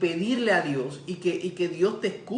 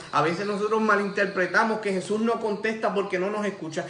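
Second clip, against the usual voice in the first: ordinary delivery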